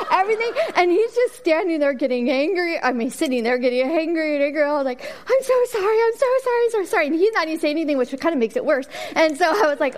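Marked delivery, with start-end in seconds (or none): 5.0-7.1 high, panicked repeated sorry; frantic apology. Retold or imitated. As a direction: gush apologies in a flustered panic. Apologizing to the soaked stranger.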